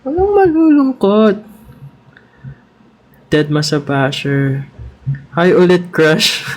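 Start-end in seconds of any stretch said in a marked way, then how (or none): 0.0-1.4 much higher, bright sing-song cheer. Real, not acted. Streamer cheering up her sad viewers, high and playful. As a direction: lift everyone's mood with a high, playful voice.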